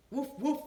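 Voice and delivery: loud, high barked woofs; dog barking. A narrator's reading. Dog answers Benny with loud high barks. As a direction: bark like an eager puppy. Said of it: "said his dog".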